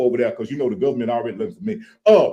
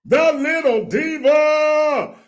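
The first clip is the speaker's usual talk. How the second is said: high, slow, stretched announcement; drawn-out hype intro; 4 dB louder, much slower than usual. Acted. Host introducing the co-host with a slow, high showman call. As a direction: roll the name out like a showman.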